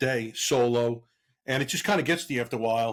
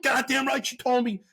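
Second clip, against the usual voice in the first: higher, indignant snap back; indignant retort; 12 semitones higher than usual. Retold or imitated. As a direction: fire it right back at mom. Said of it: "I'm like".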